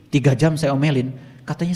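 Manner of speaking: ordinary talk, nothing marked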